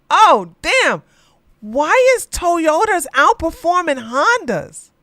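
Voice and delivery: loud and high, genuinely startled; sudden loud shocked cry, voice jumps up; shocked outburst. Real, not acted. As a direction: blurt it out in disbelief. Shocked reaction to car chart.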